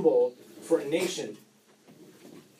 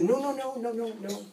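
put-on eager child refusal; 6 semitones higher, 5 dB quieter, faster than usual. Son refuses rescue first.

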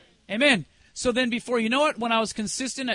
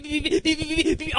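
rapid string of higher beeps; imitated watch beeps; 5 semitones higher than usual. Showing how the watch went all day, insistent beeping. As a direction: mimic an alarm that keeps going off. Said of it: "It was like going beep".

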